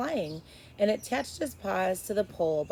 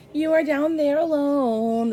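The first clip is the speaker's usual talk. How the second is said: slow, louder, higher star voice; stars' voice; 7 semitones higher, 8 dB louder, slower than usual. A narrator's reading. Stars taunting lonely Edward, slow and loud. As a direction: speak down from the sky, slow and grand. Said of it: "the stars seem to say".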